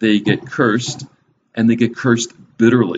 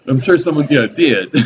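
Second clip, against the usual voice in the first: talking through laughter, pitched up; real laughter; 5 semitones higher than usual. Real, not acted. Preacher amused by the blow-by-blow death verses, laughing. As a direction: crack up while talking. Cracks up after grim verse.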